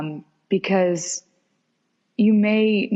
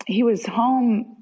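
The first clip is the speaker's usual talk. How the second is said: usual speaking voice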